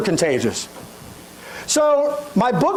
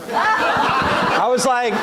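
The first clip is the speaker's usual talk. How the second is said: laughing; 2 semitones higher, much slower than usual. Real, not acted. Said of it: "laughing while telling".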